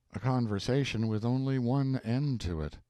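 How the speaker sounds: usual speaking voice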